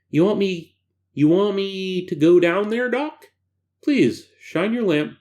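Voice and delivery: high, whiny, nasal-sounding character; annoying voice. Acted. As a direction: play him grating and pleading. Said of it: "that's a little too annoying a voice".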